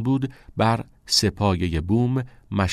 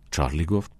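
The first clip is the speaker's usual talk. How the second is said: plain reading voice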